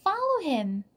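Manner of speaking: high, urgent call; crying out. A narrator's reading. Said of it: "cried Jack".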